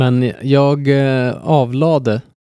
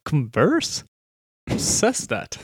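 put-on, scoffing disbelief at the pronunciation; mocking American disbelief; 2 semitones higher, 7 dB quieter, much slower than usual. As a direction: imitate incredulous Americans laughing at the word. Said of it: "De bara". (härmat, hånfullt förvånad)

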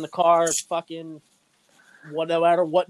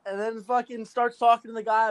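ordinary talk

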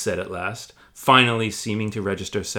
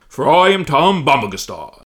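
louder grandiose giant voice; pompous Tom; 4 semitones higher, 9 dB louder than usual. Acted. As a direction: boast like a vain hero. Tom proclaims.

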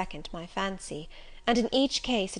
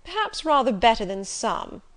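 Rochester, commanding; 2 semitones higher, 8 dB louder than usual. Acted. Rochester judges her playing.

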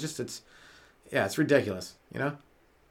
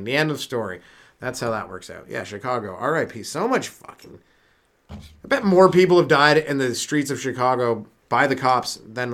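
ordinary delivery

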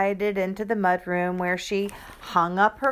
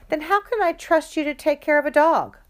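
exasperated mom; 9 semitones higher, 5 dB louder, faster than usual. Acted. Mom scolding daughter.

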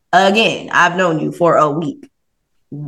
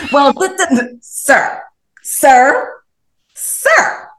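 stammering disbelief; 6 semitones higher, much slower than usual. Real, not acted. Stunned, scolding him.

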